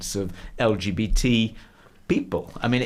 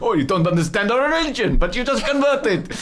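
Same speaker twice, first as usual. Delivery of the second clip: high, loud hammed-up Arabic accent, breaking into laughter; the potentate brushing off the women in mock Arabic accent; 10 semitones higher, 5 dB louder than usual. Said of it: "He's actually doing the accent".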